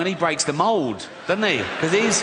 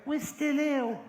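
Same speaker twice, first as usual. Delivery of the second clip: higher, put-on voice; mock sick teen; 10 semitones higher, 7 dB quieter, slower than usual. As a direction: lightly voice a sickly caller. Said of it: "but they called again".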